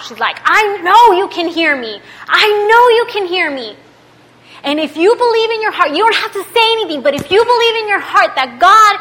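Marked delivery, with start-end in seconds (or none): 0.4-3.8 high, loud, insistent retelling; saying out loud. Retold or imitated. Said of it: "she started saying out loud". 4.6-8.9 raised, urgent retold preaching; preaching at him. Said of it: "preaching at him".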